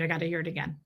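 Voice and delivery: normal talking voice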